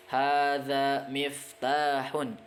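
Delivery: Hadza masjidun drawn out slowly and clearly; teacher modelling Arabic slowly; slow model reading. Shown as a demonstration. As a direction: read it slowly for students to repeat. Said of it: "model Arabic readings slowly".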